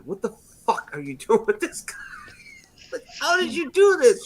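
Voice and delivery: high, laughing disbelief; mock incredulity. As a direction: squeak it out in baffled amazement. Acting shock at friend's wife choice.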